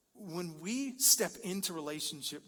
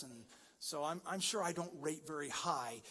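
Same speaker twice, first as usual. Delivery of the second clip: usual speaking voice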